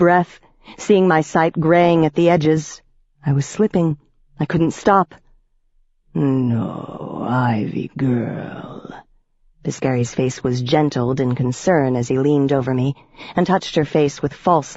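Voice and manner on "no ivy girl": lower, slow, softer fake comfort; falsely tender vampire. A narrator's reading. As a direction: soothe her with false love. Piscary soothes Ivy.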